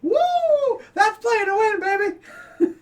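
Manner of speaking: whooping, very high, loud victory cry; celebratory yell. Acted. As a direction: yell it like you just hit the jackpot. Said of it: "Do you yell that midnight?".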